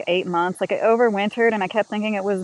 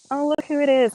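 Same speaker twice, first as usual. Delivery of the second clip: high, louder pet-talk greeting; cooing at the cat; 8 semitones higher, 6 dB louder than usual. Real, not acted. Owner greeting her cat, high cooing pet voice.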